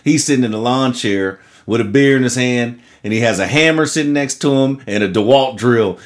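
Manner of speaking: ordinary delivery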